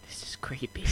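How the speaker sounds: hushed unvoiced whisper; creepy whisper. Real, not acted. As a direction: whisper it, spooked. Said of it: "We didn't mean to do that".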